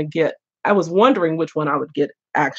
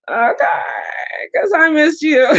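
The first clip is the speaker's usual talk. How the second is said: high-pitched cutesy teasing; sing-song high, playful fondness; playful affection; 9 semitones higher than usual. Real, not acted. Playfully scolding a returning viewer.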